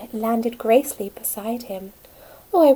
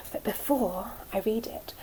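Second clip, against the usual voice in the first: whisper; 8 dB quieter than usual. Real, not acted. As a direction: drop to a secret whisper. Teacher whispers to nursery children.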